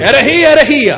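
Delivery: mock outcry. Interpreter echoing the preacher's heresy charge, mock outcry. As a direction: cry out the charge in mockery.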